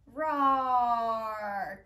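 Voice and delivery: big, loud roaring growl; loud lion roar. Shown as a demonstration. Teacher roaring like a lion for the children. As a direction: let out a full lion roar. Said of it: "Let it out when you're ready".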